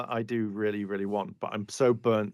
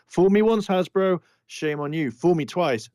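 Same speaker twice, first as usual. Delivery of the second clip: mock proverb; 7 semitones higher, 5 dB louder than usual. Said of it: "proverb played up mockingly".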